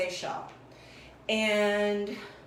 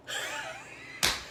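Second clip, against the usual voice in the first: high laugh; 6 dB quieter than usual. Real, not acted. Laughs at her own demo.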